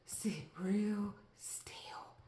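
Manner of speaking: slow breathy whisper; talking to a lizard on TV in a slow whisper; hushed whisper. Retold or imitated. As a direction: whisper gently so it stays put.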